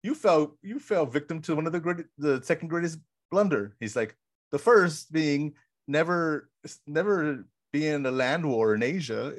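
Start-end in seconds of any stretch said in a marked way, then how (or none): 0.0-3.7 higher, gloating movie-villain voice; mock Vizzini. Vizzini quote retold. 4.5-9.3 slow, higher, pompous lecturing; mock Vizzini. Vizzini quote retold.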